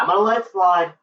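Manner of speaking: grudgingly making a point of it; emphatic concession. Real, not acted. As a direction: announce it pointedly, pitch lifted. Conceding, first take emphatic.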